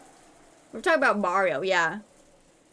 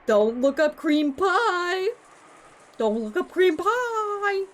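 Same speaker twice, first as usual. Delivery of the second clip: mock warning; 8 semitones higher, 3 dB louder, slower than usual. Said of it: "It was like".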